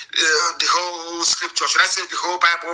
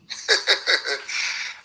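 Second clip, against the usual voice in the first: scornful chuckle; 4 dB quieter than usual. Real, not acted. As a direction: chuckle softly to yourself. Laughs at his own question.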